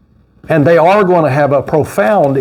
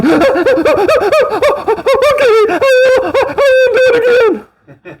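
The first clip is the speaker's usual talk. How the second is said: put-on pleading child. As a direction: beg like a punished kid promising to behave. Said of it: "they were going".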